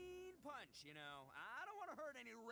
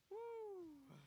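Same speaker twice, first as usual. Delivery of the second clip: relieved exhale; 11 semitones higher, 5 dB louder than usual. Acted. Relief after catching his breath.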